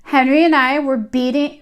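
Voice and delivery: ordinary delivery, nothing marked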